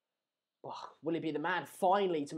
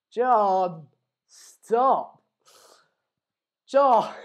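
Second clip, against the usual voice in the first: calling out; 3 semitones higher, 9 dB louder, much slower than usual. Real, not acted. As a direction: call his name firmly across the room. Calling to child John.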